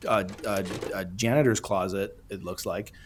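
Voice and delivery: ordinary talk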